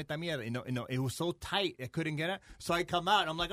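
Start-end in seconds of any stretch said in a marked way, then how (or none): none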